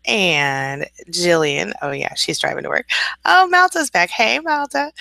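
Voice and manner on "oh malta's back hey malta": excited greeting. Real, not acted. Greeting returning viewer.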